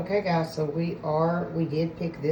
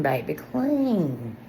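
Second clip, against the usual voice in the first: sassy slow clean; much slower than usual. Real, not acted. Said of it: "I just feel so clean".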